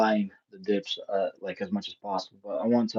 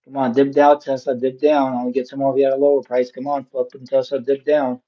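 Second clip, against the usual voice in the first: loud pleading; 3 semitones higher, 7 dB louder than usual. Real, not acted. Yelling at Tesla to dip.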